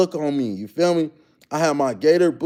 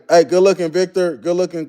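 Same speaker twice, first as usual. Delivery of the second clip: normal talking voice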